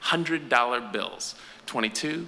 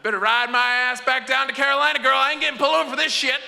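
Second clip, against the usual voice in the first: high, loud, fast yell; hollering orders to his partner; yelling at Susan; 12 semitones higher, 4 dB louder, faster than usual. Retold or imitated. Dealer hollers at Susan to drive him home, high loud shout. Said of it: "and goes".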